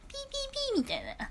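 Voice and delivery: high, fast mock beeping squeal; imitated squeal. Speaker mimicking noisy shrieking people, high fast squeal. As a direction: squeak it out like a shrill whistle.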